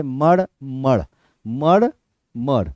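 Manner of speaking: "मड़" said as a separate syllable, with pauses between; syllable demonstration. Shown as a demonstration. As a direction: sound out "मड़" one beat at a time. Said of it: "pronunciation से इस spelling को हम लोग पकड़ सकते". ("मड़" को अलग-अलग syllable में बोलना)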